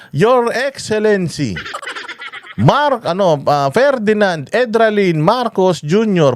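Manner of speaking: slow, drawn-out, high formal announcement; hammed-up MC announcing the president; mock emcee introduction. Mocking emcee introducing the president, slow and grand. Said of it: "habang pinakikilala siya".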